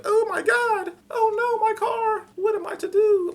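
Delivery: very high shrill falsetto panic; mock helpless damsel. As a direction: ham up a helpless victim. Mocking helpless victim.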